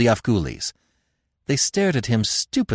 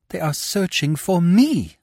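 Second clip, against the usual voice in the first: higher, eager exclamation; exclaimed; 7 semitones higher than usual. Acted. Devi realising her riders seek her, higher eager exclamation. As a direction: blurt it out in sudden hope. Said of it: "she exclaimed".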